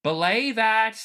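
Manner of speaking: loud, high shouted order; urgent far-carrying call. Acted. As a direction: shout a command down the hallway. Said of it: "you just hear a voice call out".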